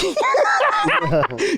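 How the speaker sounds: rising giggling laugh; high laugh. Real, not acted. Telling a Bush story, high rising laugh.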